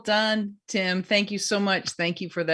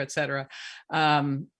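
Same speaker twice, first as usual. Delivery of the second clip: ordinary delivery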